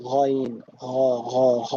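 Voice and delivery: "গইন" and "ঘ" gargled from the tongue root; pressed back-of-tongue sound. Shown as a demonstration. Teacher showing Arabic ghain, throaty "ঘ". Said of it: "একেবারে জিভের শেষে এসে".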